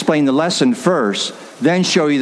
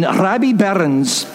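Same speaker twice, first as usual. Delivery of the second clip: Scottish pronunciation of the poet's name; Scottish accent; 3 semitones higher, slower than usual. Retold or imitated. Preacher naming the poet as his Scottish father did, Scottish accent. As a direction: say the name like a Scot. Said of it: "or as he would say".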